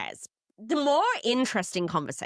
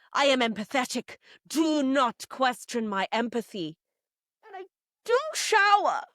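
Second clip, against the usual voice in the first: [name], indignant; 4 semitones higher than usual. Acted. Hypnotherapist defending herself at the round table, mock indignant. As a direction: play an affronted diva stressing every claim. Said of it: "Hypnotherapist [name] opens".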